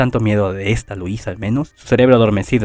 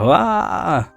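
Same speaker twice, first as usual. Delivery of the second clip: a short, high "ah" of a mock scream; a sudden yelp, pitched high; 7 semitones higher than usual. A narrator's reading. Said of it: "Y Subaru grita".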